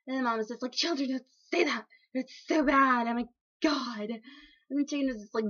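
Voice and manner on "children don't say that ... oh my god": louder, higher voice of a shocked mom; hammed-up motherly telling-off; mock scolding. Chicken mom to her swearing children, mock-scandalized scolding.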